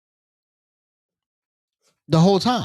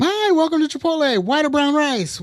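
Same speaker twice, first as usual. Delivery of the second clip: much higher sing-song counter greeting; cheerful server greeting; 14 semitones higher than usual. Retold or imitated. Manager imitating a cheerful Chipotle worker, high sing-song greeting. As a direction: chirp it like a bubbly fast-food server. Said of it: "doing the Chipotle thing".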